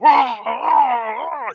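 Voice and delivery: loud, high hammed-up yells of pain; imitating Freddy taking bullets; mock pained yells. Freddy getting shot up.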